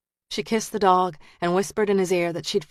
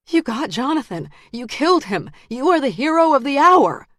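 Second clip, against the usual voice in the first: rising panic; 8 semitones higher, 5 dB louder than usual. A narrator's reading. Lara pleads, rising panic.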